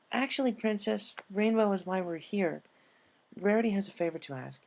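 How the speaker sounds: polite Twilight. Acted. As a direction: address a princess politely. Narrator voices Twilight.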